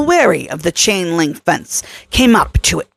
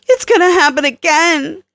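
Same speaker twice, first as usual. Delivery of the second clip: Kelly, worried; 9 semitones higher than usual. A narrator's reading. Kelly begging Troy not to provoke the jealous T-Rex, worried. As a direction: soften the plea, a touch shaky.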